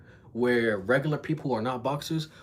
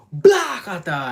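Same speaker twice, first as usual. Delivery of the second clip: loud, high mouth-made smack; imitated punch impact; 8 semitones higher, 11 dB louder, slower than usual. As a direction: punch sound effect, shouted out.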